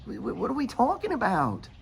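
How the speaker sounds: high, exasperated no-no-no; mock his own disbelief at them; incredulous retort. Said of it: "well, it's like".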